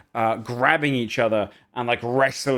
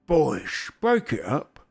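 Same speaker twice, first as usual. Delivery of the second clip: put-on ogre voice, a little higher; ogre breaking up fight; 4 semitones higher, much slower than usual. Bookish ogre to his two wrestling brothers, in an ogre voice. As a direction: intervene lazily as the bookish ogre. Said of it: "he kind of looks at him and goes".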